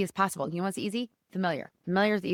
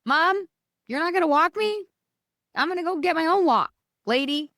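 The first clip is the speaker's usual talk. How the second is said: high, louder sassy dog voice, fast at the end; voicing the dog; 9 semitones higher, 4 dB louder than usual. The dog Nugget sassing his owner in a high, louder voice. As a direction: play the runaway dog teasing his mom. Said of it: "He's like".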